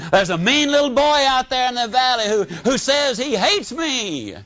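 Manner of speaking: put-on a scared kid running in to tell his mom; frightened little boy. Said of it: "he said".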